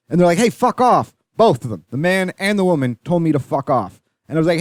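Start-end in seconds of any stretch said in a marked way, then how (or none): 0.4-1.1 higher-pitched mock annoyance; pissed-off rebuff. Interrupted couple telling a passerby to leave, pissed off. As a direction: imitate an irritated couple, pitched up. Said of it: "they were quite pissed off at me".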